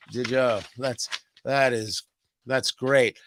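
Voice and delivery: a sniffy recruiter reading a resume; mock unimpressed. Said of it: "we should say".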